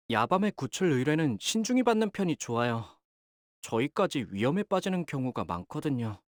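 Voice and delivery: ordinary talk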